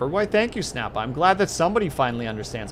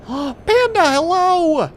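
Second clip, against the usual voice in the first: high, loud excited greeting; 8 dB louder, much slower than usual. Real, not acted. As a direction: squeal a happy hello.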